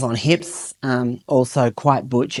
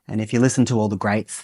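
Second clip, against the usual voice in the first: ordinary delivery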